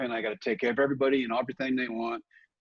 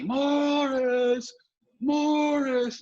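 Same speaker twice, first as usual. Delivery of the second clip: high, drawn-out mock heckler call; heckling fans calling; much slower than usual. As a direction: sing out the name like taunting fans. Bleacher creatures calling out.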